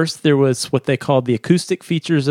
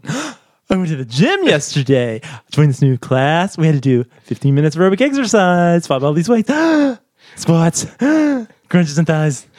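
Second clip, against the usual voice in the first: dog-directed speech; 4 semitones higher than usual. Shown as a demonstration. Reading a workout script to dogs, in excited puppy talk. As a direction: gush a workout story like praising a puppy, gasping between words. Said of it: "content mismatched dog-directed speech".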